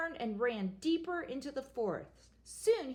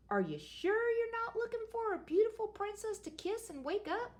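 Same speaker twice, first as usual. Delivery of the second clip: witch voice; 11 semitones higher than usual. Acted. First witch speaks.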